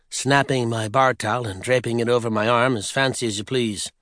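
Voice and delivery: plain reading voice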